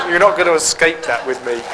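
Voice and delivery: chuckling through the words; a teasing laugh running under the line. Real, not acted. Teacher teasing a questioner about her tapes, laughing.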